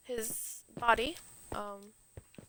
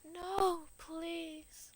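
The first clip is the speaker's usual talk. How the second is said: higher, hammed-up anguished plea; mock grieving cry; 6 semitones higher, 8 dB louder, much slower than usual. Apollo begging over his dead lover, hammed-up anguish. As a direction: wail over the fallen boy, overacted. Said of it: "He's like".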